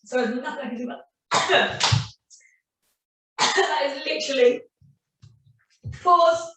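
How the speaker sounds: talking through a coughing fit; hurried words cut off by a real cough; frog in the throat. Real, not acted. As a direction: push words out between coughs. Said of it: "the biggest frog in my throat".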